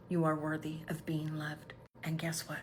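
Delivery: soft, tender whispered comfort; whispered loving reassurance. Real, not acted.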